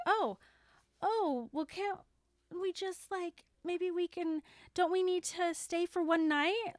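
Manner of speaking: high, hesitant mock excuses; stalling. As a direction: imitate someone fumbling for reasons to stay. Doing everything she can to.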